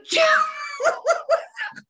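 high squealing cackle; helpless laugh. Real, not acted. Host losing it over being put on hold, helpless laughter. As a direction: crack up mid-sentence. Cracking up mid-rant.

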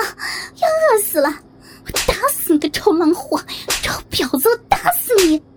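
loud, high-pitched raging tirade; furious cursing. A narrator's reading. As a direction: rant and curse, climaxing in a high scream. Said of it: "大骂道". (气疯了似的破口大骂)

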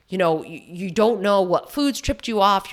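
ordinary talk, nothing marked

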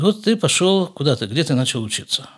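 ordinary delivery

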